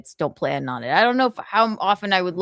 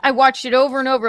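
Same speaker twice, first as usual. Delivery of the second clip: ordinary delivery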